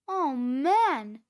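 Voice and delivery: loud burst of relief; amazed cry. A narrator's reading. Cheeseball as the glider takes off, crying out. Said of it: "cried Cheeseball".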